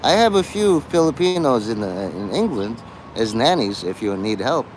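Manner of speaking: usual speaking voice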